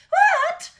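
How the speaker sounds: very high, loud shocked yelp; spluttering queen. A narrator's reading. Queen seeing the daughter on a dragon, shocked yelp. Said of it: "spluttered the queen".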